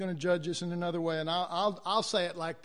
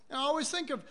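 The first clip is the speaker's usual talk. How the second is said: usual speaking voice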